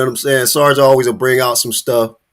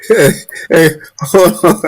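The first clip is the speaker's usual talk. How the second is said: higher, louder, laughing hey hey; excited interruption; 6 semitones higher, 5 dB louder than usual. Real, not acted. Host cuts into the clip, excited and laughing. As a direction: cut in, cracking up.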